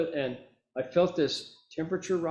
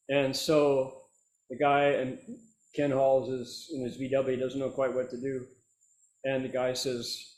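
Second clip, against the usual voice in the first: ordinary delivery, nothing marked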